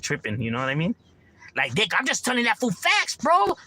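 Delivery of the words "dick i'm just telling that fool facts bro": mock defensive, pitch jumping up; cocky critic. Imitating a guy who insults his friend, rising and defensive.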